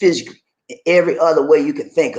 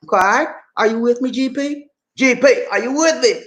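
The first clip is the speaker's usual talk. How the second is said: hype call; 9 semitones higher than usual. Real, not acted. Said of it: "hyping up the class".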